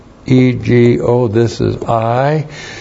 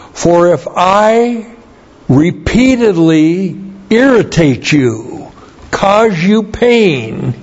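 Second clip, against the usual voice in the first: higher, slow and deliberate translation; slow emphatic reading; 7 semitones higher, slower than usual. Shown as a demonstration. Pastor reading his corrected translation slowly, with weight. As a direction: lean on each word of the verse. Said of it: "So the corrected translation is".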